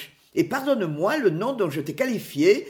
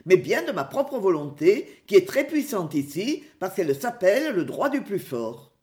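ordinary delivery

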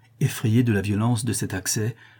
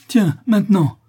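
high, loud strident cry; 10 semitones higher, 7 dB louder than usual. A narrator's reading. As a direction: call it out stridently, pitched way up. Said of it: "dit le notaire d'une voix stridente".

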